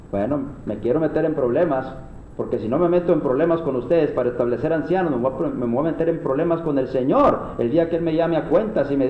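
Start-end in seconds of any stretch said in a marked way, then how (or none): none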